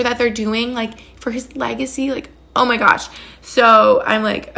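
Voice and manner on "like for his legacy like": ordinary talk, nothing marked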